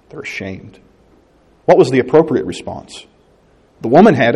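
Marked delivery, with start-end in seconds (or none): 0.1-0.8 "They're ashamed" repeated much softer, sinking away; soft echo. Shown as a demonstration. Preacher repeating it softly to the congregation, hushed. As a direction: let the repeat fall to a near hush. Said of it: "And they're ashamed".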